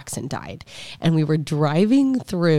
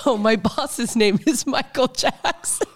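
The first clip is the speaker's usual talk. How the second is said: higher, slower, lightly voiced clerk; mock pharmacist; 7 semitones higher, slower than usual. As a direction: play the puzzled clerk gently. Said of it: "And he said".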